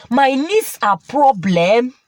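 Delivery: plain reading voice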